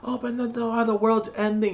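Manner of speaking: high, frantic panicked voice; panicked cry. Retold or imitated. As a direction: act out a man who thinks the world is ending.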